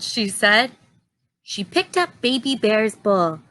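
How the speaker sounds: ordinary delivery, nothing marked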